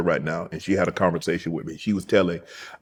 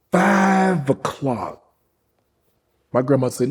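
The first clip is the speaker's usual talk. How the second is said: usual speaking voice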